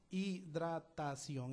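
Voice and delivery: Hidratación drawn out as hi-dra-ta-ción; syllable by syllable. Shown as a demonstration. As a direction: say Hidratación slowly, one syllable at a time. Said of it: "descomponer en sílabas".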